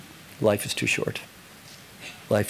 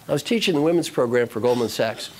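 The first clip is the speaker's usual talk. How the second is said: plain reading voice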